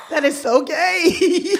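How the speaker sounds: slow, high words through laughter; cracking up mid-sentence; real laughing fit. Real, not acted. Laughing while repeating.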